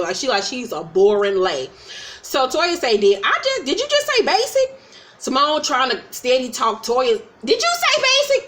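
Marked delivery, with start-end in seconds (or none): 3.0-4.8 high-pitched imitation of an offended woman; mock aggravated. Housewife challenging a friend who called herself basic, mock indignant. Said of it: "She gets aggravated and say". 7.4-8.5 very high imitation of someone butting in; mock aggravated. Housewife talking over her friend, mock outrage. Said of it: "Talking over her and shit".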